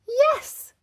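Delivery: high, loud yes; gleeful shout; excited cry. A narrator's reading. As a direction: burst out with a child's delight. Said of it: "cried Peppa".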